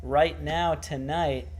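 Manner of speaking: ordinary talk, nothing marked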